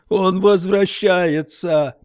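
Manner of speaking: high, slow, drawn-out cry of terror; terrified man yelling the creature is coming. A narrator's reading. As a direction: wail the warning. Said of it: "орал Стерджис".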